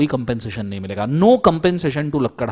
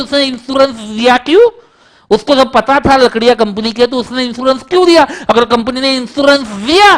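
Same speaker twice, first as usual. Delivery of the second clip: high, loud student pressing a tricky question, slowly stretched; a cheeky pupil sure he has caught the teacher out; eager student Champat; 9 semitones higher, 9 dB louder than usual. Retold or imitated. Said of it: "बोला". (ऊँची तेज़ आवाज़ में चालाक छात्र का सवाल)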